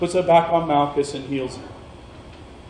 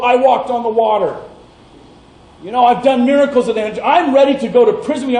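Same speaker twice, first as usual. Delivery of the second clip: boastful Peter; 6 semitones higher than usual. Retold or imitated. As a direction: puff up your chest and boast. Peter boasting of his courage.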